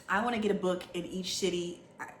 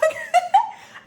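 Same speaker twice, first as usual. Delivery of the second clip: high laugh; 10 dB louder than usual. Real, not acted. Laughs about the horror book.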